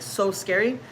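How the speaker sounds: usual speaking voice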